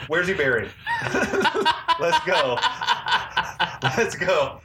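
high, laughing mock eagerness. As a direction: crack up while rallying a pretend expedition. Joking about digging up the skull.

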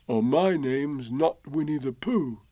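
lightly voiced musing bear; Pooh, to himself. A narrator's reading. Pooh reasoning to himself, musing. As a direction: think it through aloud. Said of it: "said Bear to himself".

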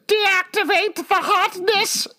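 high, drawn-out mock voice, painfully over the top; villain-like quoted line. Podcaster quoting a favorite line to co-hosts, hammed-up high voice. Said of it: "That was the worst voice".

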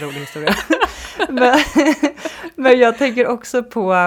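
high, loud laughter breaking up the words; helpless giggle fit; laughing hard. Real, not acted. Host laughing at guest's funny story, loud laughter. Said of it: "gud vad jag skrattar".

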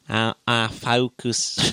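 slow Kiwi vowels on focus; New Zealand accent. Shown as a demonstration. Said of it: "it's a bit more".

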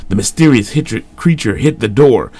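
ordinary delivery, nothing marked

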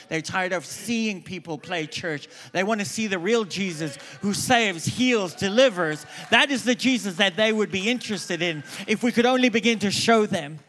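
fiery sermon peak; preaching at full force. Acted. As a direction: build the crowd up to Jesus. Preaching climax.

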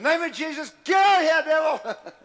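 high, loud rebuke of the devil; preacher shouting at a demon; commanding shout. Acted. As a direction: blast the devil out with authority. Casting out the demon.

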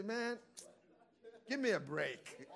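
lightly voiced scoffing demon; mocking demon voice. Demon mocking weak prayer.

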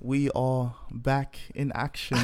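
put-on swaggering, drawn-out British accent. Host greeting listeners back for a bit, swaggy British accent. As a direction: open the show in a cocky British accent. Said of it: "that was my little British swaggy accent".

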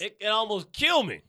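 high, loud mock gushing; exaggerated high-pitched yell of delight; hammed-up fan raving. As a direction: shout it like an overwhelmed fan. Acts out a fan overcome by bit.

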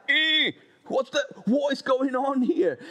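hammed-up what-on-earth reaction; baffled. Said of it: "It would be like".

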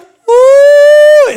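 very loud, high "ooh" howl; imitated crying wail. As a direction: howl like a man bursting into tears. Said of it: "He starts crying".

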